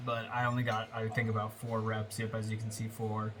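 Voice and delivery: ordinary talk, nothing marked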